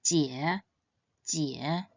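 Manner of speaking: "jie" dipping low, then rising; Mandarin third tone. Shown as a demonstration. Teacher models the word for older sister, "jie" dipping then rising. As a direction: sink on "jie" and lift at the end. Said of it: "It should be read in the third tone".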